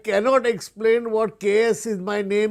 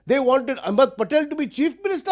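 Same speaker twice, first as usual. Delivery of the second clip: ordinary delivery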